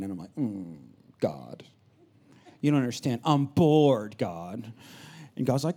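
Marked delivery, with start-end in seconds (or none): 0.4-4.7 soft, low whine; mock sulk at God; pouting complaint. Preacher pouting to God like his bored kids. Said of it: "I pout, and I complain".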